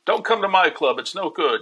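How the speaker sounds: a hint of Rodney waving him off. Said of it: "quoting Rodney".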